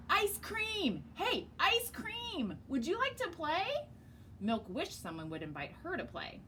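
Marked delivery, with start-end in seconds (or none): 0.1-3.7 high, slow call across the yard. Acted. Waffle calls Ice Cream to play, high and drawn out. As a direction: reach the kid far away.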